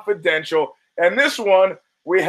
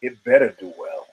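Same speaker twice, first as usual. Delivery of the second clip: mock threatening boss; 10 semitones lower than usual. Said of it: "leaning toward the camera with that look".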